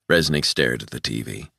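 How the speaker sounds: ordinary delivery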